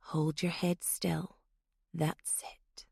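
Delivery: slow, soft, fading to a whisper; calm threat close to her face; menacing whisper. Acted. Threatening with knife at eye.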